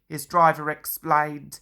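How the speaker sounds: ordinary delivery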